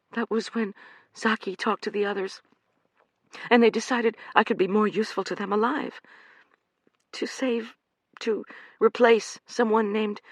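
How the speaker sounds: high pleading woman's voice; frightened young woman. Acted. Amanda pleading with the men, frightened and high. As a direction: beg like a trapped animal. Amanda explains her captors.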